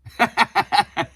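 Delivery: loud, helpless giggle; amused laughter. Real, not acted. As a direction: burst of high, loud chuckling. Laughs at reading the joke comment.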